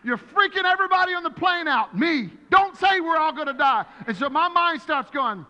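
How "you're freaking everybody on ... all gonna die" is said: loud, high scolding; angry scolding. Retold or imitated. Pastor scolding teammate for scaring passengers, loud high scolding. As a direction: scold him hard. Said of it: "I turned around and said".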